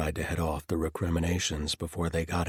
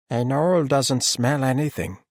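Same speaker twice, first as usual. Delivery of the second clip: higher, louder gnoll voice; 9 semitones higher, 9 dB louder than usual. Acted. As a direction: speak as the gnoll. Gnoll character voice.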